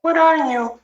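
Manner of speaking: very high cooing question; high-pitched pet voice. Real, not acted. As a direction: ask a dog in a high doting voice. Asking her dog its sign.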